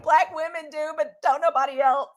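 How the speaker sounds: high, loud retold declaration; emphatic answer. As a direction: belt out the answer with conviction.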